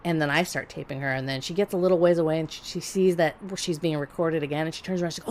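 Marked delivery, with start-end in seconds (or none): none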